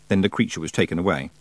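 usual speaking voice

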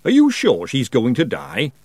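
slow, slightly doubtful question; uncertain. A narrator's reading. Butcher questions the girl, a touch uncertain. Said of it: "uncertainty clouding his face".

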